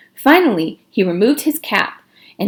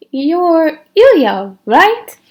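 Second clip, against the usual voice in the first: high, slow wondering voice; a dreamy girl checking his name; girlish question; 8 semitones higher, 4 dB louder, much slower than usual. A narrator's reading. Myra, just entering, asks Ilya his name in a high slow voice. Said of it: "she asked".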